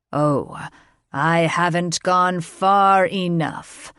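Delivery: ominous dragon king. A narrator's reading. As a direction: threaten slowly and darkly. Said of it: "an ominous growl".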